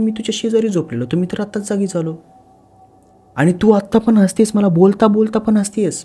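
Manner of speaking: high and loud, pressing harder; a rattled husband pushing back at his wife; alarmed, rising to near shouting; agitated accusation. Acted.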